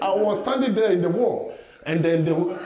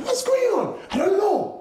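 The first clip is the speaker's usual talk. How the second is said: panicked protest; 14 semitones higher than usual. Retold or imitated. Panicked reply to police.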